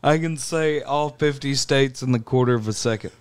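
Family Guy impression. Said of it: "Do the Family Guy alphabet line".

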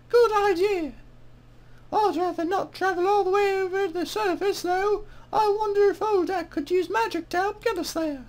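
high-pitched mock British woman; female-voiced British accent. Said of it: "my horrible female voiced British accent".

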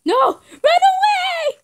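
high, loud, slow screaming cry; panicked shout. Real, not acted. Gamer screaming to her teammate to flee.

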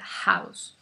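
"house" with a breathy h like a Spanish j; aspirated h. Shown as a demonstration. Teacher showing the English h to Spanish speakers. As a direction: breathe out hard at the start of "house". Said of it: "sacando aire como la J".

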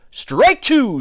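high, loud umpire call; umpire's shout. A narrator's reading. Umpires calling a strike on the batter, shouting. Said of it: "shouted the umpires".

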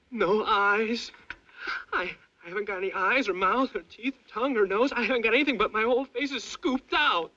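high, loud rising horror; horrified. Acted. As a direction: realize the horror, voice climbing. Horror building, face gone.

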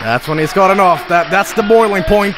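ordinary talk

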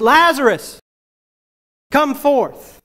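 calling out high and loud into the tomb; loud cry. Acted. Jesus calling his dead friend out of the tomb, loud commanding cry. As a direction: cry the name out with full force. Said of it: "he cried out in a loud voice".

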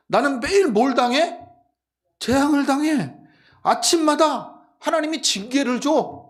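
높고 큰 목소리로 억울함을 연기; 시편 기자가 되어 격하게 토로하기; 억울한 하소연. Acted. 아삽의 하소연을 연기하는 설교. (격앙된 하소연 흉내)

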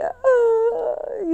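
very high, drawn-out "ay"; frightened wail. Retold or imitated. Climber slipping almost onto a body, high frightened wail. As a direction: imitate a long high cry of fright. Reliving slip near a body.

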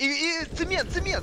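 high excited shriek; excited high yelp. Real, not acted. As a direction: squeal out the callout. Sudden callout mid fight.

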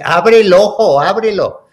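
loud, high retold command; insistent order. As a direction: demand it like a worried parent. Mother ordering injured child.

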